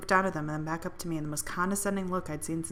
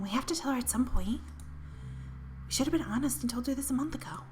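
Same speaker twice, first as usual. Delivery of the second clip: hushed, higher, secretive whisper; 6 semitones higher, 4 dB quieter than usual. Acted. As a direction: whisper it so she can't hear.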